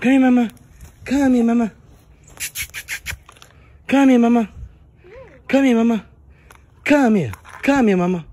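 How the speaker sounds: high, sing-song call to a dog. Real, not acted. Dad coaxing the dog to jump up to him, high sing-song call.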